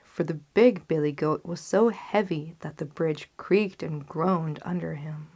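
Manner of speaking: plain reading voice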